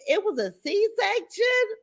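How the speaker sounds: wait, what, a C-section; slow, high, stunned echo of "It was a C-section?"; surprised question. Real, not acted.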